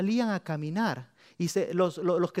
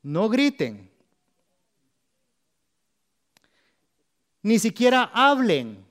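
loud, higher, slow command; Joshua's order; 7 semitones higher, 11 dB louder, slower than usual. Acted. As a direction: bark the order to the troops. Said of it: "ordenó Josué".

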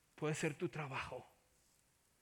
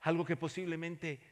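loud, fervent preaching; 10 dB louder than usual. Acted. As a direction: press the listeners with urgency. Preaching to the congregation.

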